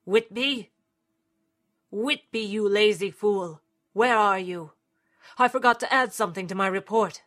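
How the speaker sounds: loud, impatient summons; calling out. A narrator's reading. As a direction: shout down for the lazy archivist. Agent calling for absent archivist.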